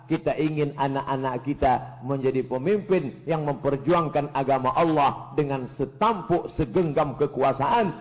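usual speaking voice

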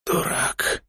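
hoarse, breathy whisper; wheezy voice. A narrator's reading. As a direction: rasp it out weakly. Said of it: "сипло сказал Илья".

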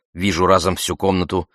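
usual speaking voice

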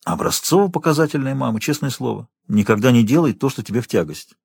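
ordinary delivery, nothing marked

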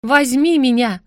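loud, confident call. Acted. Heroine ordered by demon, loud and confident. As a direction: shout it out with confidence. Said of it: "Громче, увереннее!".

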